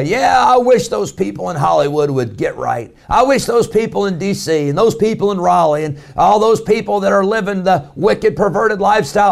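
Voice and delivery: lightly mock the pious folks pointing fingers; preacher lightly imitating a smug congregant; mocking smug churchgoer. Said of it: "Now, you say".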